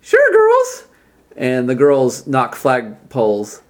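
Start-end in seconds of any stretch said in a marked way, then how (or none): 0.0-0.9 squeaky falsetto muscleman; very high, loud jock voice; high-pitched voice. Acted. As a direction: greet the crowd in a very high falsetto. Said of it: "says in a high-pitched voice".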